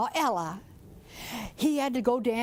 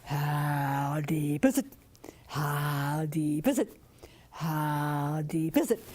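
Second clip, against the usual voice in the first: much lower, slow croaked question; deep frog talk call; 7 semitones lower, slower than usual. Acted. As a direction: croak it slow to the old bullfrog. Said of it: "call to the big old granddaddy bullfrog".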